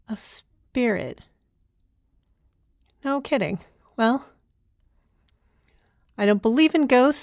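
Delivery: ordinary delivery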